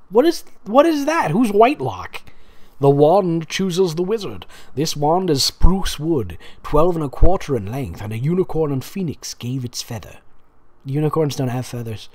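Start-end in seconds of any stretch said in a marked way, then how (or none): 0.1-2.2 high, incredulous questions; genuinely thrown by the name; baffled. Real, not acted. Reacting to a strange name in the fic, high and baffled. 2.8-10.2 a hint of a grave old wandmaker; Ollivander. Ollivander's wand speech.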